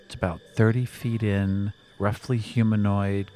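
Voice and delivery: ordinary talk, nothing marked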